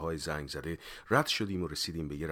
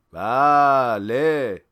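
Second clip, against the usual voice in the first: loud, drawn-out classroom answer; high, very slow chanted shout; schoolchildren shouting; 6 semitones higher, 15 dB louder, much slower than usual. A narrator's reading. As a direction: answer like a whole class of pupils. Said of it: "عین بچه مدرسه ها داد زدن".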